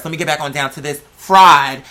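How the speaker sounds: ordinary delivery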